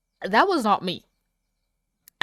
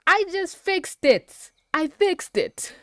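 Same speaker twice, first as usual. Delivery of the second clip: slow, high, louder gloating; triumphant; 10 semitones higher, 6 dB louder, slower than usual. Real, not acted. Boasting she fixed the plot, triumphant and slow. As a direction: savour it like you solved everything. Proud of her own plan.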